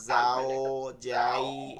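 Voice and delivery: very slow যাও যাই, each glide dragged out; wrongly drawn-out vowels. Shown as a demonstration. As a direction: stretch both যাও and যাই far too long. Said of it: "এরকম টান হয় নাই".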